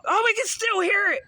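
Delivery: high, wondering bystander voice; mock amazed bystanders. Mimicking neighbors amazed at the radio. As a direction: gush like a stunned onlooker. Voicing impressed onlookers.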